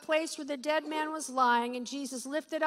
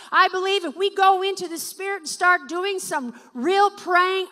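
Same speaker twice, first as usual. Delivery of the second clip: loud, fervent pulpit preaching, pressing each phrase; preaching at full force; 2 semitones higher, 9 dB louder than usual. Acted. Preacher urging his praying church, loud and fervent. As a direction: drive it home to the congregation like a revival preacher.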